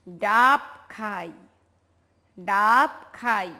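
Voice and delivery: slow, drawn-out model reading; measured word-by-word recitation; reading aloud together. Shown as a demonstration. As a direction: stretch each word for children to repeat. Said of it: "আমরা একসাথে বলি সবাই".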